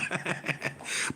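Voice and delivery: quiet unvoiced chuckle; soft breathy laugh. Real, not acted. Amused at his own bit, breathy chuckle.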